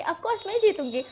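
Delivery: child mimics mother's smug brag, pitched high; mock boast. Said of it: "तो वो बोलेंगे".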